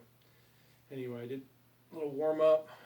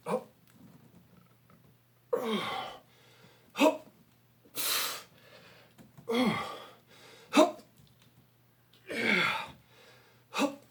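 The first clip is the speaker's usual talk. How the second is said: straining effort shouts; 9 semitones higher, 5 dB louder, slower than usual. Real, not acted. Grunting reps during pull-ups.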